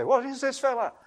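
lightly voiced baffled relative asking; puzzled relative.

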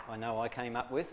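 ordinary delivery

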